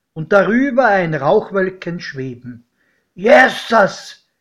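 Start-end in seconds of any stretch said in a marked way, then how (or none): 3.1-4.3 loud startled cry, pitched up; shout. A narrator's reading. Godfather seeing the train below, shouting in alarm. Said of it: "schrie mein Pate".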